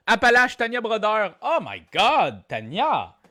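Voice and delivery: loud, high burst of amazement; excited announcement. Real, not acted. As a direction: call out the winner, stretching the exclamation. Host announces a correct answer.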